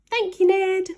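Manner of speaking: high, loud grateful cheer; cheering cry. Acted. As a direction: call it up to the sky with joy. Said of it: "they cried".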